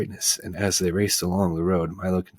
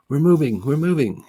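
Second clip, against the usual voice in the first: louder, higher excited cry; happy shout; 9 semitones higher, 7 dB louder than usual. Acted. Milo cheering as the car starts, happy shout. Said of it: "he shouted happily".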